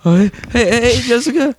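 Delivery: high, nervous squeaky Morty impression; hammed-up high nervous Morty. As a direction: stammer it up high like a flustered teen.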